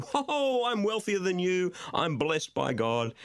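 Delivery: long high whoa, sliding down into a brag; mock boasting. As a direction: crow like a smug show-off. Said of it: "people running around saying".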